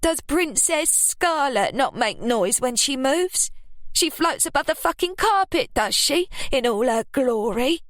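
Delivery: mock her cousin's airs. Ruby mocking her cousin.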